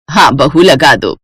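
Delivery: a hint of an aged mother agreeing; old mother-in-law calmly saying yes to her bahu; elderly mother-in-law. Old mother-in-law answers daughter-in-law.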